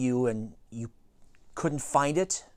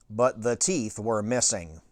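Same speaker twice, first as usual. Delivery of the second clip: ordinary delivery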